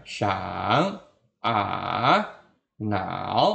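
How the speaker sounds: SHANG, A, NAO low, dipping then rising; third tone. Shown as a demonstration. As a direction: sink each of SHANG, A, NAO and lift it. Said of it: "Ini nada ketiga". (SHANG, A, NAO nada ketiga, turun lalu naik)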